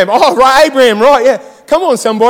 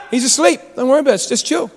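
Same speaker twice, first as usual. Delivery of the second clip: softer, unbothered reply; calm reassurance; 5 dB quieter than usual. Acted. Calming someone who is freaking out, softly unbothered. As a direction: play it cool after the panic.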